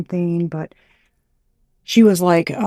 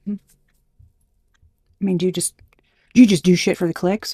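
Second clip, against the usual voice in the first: ordinary delivery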